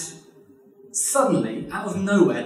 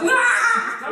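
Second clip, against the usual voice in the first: very high hammed-up shriek; a laughing mock cry of a ranting actor; mock stage scream; 4 dB louder than usual. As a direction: let out a theatrical wail. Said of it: "And he's like".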